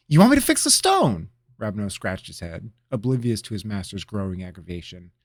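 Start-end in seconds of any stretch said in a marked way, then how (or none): 0.1-1.3 high, loud eager cry; excited interruption. Acted. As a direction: jump in bursting with an idea. Apprentice guessing, oblivious.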